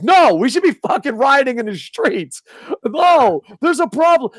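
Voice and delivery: high-pitched ranting protest; worked-up, alarmed objection; exasperated outrage. Acted. Acted outraged reply to calm fans.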